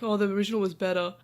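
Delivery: quick, salty fan grumble; lightly imitated bitter fan; mock salty. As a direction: toss it off as a put-on grumbling purist. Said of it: "not in a sort of salty".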